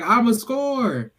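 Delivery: high, drawn-out imitation of an eager kid; mock eager cry. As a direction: stretch it out like a kid charging in. Kid jumps the gate to play.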